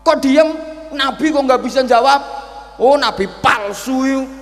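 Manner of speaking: high, loud angry scolding; angry old man. Acted. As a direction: snap at the silent prophet. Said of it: "Marah-marah dia". (kakek tua marah membentak Nabi)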